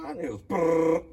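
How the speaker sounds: mock engine brrr, high and loud; imitated engine giving out; engine sputter noise. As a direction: rattle it out like a dying motor.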